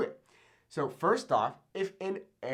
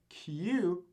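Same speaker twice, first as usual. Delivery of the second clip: normal talking voice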